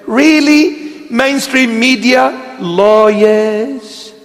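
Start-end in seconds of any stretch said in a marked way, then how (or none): 2.6-4.1 put-on drawn-out word in a Lebanese accent. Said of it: "That was a Lebanese accent".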